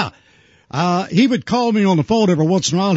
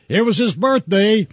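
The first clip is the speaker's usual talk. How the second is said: ordinary delivery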